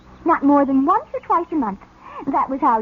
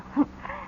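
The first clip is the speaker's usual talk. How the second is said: breathy giggle; 6 semitones higher, 10 dB quieter than usual. Acted. Flirting with David.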